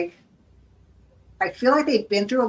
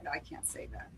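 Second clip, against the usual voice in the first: breathy whisper under her breath; whispered to self; 6 semitones lower, 20 dB quieter than usual. Real, not acted. Hesitant reader whispering to herself. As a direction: hold it back, barely voiced.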